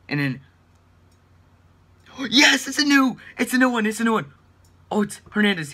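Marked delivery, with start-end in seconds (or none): 2.2-5.7 loud, high gasping cheer; excited shout. Real, not acted. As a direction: shout the new pull out. Gasp, new walkout.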